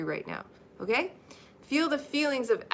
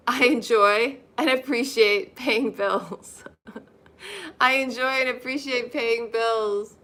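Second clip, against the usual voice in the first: slow, louder model line to repeat; slow affirmation; 3 semitones higher, 4 dB louder, slower than usual. Shown as a demonstration. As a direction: say it slowly for them to echo. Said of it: "repeat after me".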